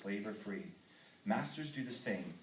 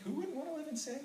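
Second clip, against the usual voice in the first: excited; faster than usual. Retold or imitated. Eager to move to San Diego.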